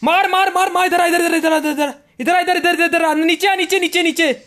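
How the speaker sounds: high-pitched, panicky stream of orders; real in-game panic; frantic callout. Real, not acted. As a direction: urge your teammates over in a firefight, words tumbling in repeats. Firefight, frantic calls to teammates.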